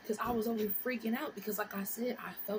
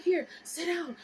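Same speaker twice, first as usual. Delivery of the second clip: higher mimicked mom; raised, insistent mom voice; mom taking charge; 8 semitones higher, 4 dB louder than usual. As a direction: imitate a mother giving orders. Mom voiced instructing her.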